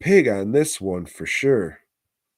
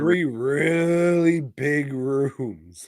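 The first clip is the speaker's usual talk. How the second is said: very slow, stretched words, pitched up; drawn-out; 4 semitones higher, much slower than usual. Acted. Co-host chimes in on the house, mock drawn-out. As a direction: stretch every word out high.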